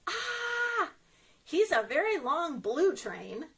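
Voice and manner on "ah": ordinary delivery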